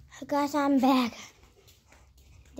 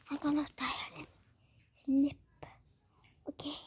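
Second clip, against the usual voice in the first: whisper; 3 semitones higher, 3 dB quieter than usual. Real, not acted. Tired, going to sleep.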